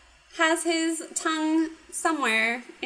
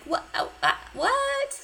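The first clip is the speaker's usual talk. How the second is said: squeaky confused what; 13 semitones higher than usual. Retold or imitated. Confused hero quoted in recap.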